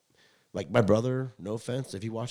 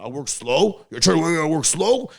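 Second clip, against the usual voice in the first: loud, higher indignant dad; offended dad; 8 semitones higher, 13 dB louder than usual. Retold or imitated. Imitating his dad taking offense, mock indignant. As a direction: rise up offended like a touchy father. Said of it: "And he's like".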